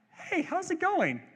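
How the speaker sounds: high, light, feigned-casual hello; mock casual greeting. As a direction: greet the approaching threat with put-on nonchalance. Greeting Egypt coming from the beach.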